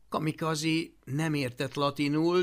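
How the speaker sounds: ordinary delivery, nothing marked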